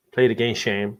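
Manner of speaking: low, lightly acted movie line; film quote impression. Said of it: "you know that film".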